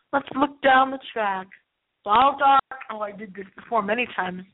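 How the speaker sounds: normal talking voice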